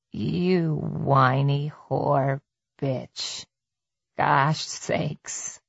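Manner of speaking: low, very slow, idle musing; casual, reflective. A narrator's reading. Attacker to the woman begging for her life, casually reflective. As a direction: muse on it as if discussing the weather. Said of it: "spoke in the tone of a man reflecting on the weather".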